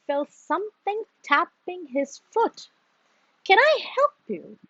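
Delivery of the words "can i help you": high, quick little frog voice; a lightly voiced small frog offering help; small frog croak. A narrator's reading. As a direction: pipe it up fast like a tiny frog. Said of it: "croaked a voice".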